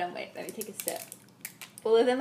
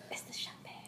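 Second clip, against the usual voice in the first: whispering; 17 dB quieter than usual. Real, not acted. Whispered aside.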